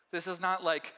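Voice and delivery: ordinary talk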